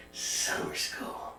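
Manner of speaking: low slow whisper of summer school; whispered doom; ominous whisper. Acted. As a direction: drop to a dark whisper. Mock-ominous punchline.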